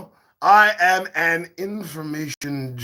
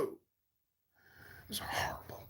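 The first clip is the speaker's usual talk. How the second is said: whisper; 23 dB quieter, much slower than usual. Acted. Lamenting yesterday's snafu.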